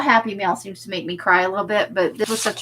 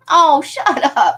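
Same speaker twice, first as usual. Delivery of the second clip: higher, louder slow mock scold; playfully fake-angry shutdown; cute pretend anger; mock angry snap; 6 semitones higher, 5 dB louder, slower than usual. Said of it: "I'm so cute when I'm angry".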